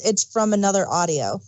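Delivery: ordinary talk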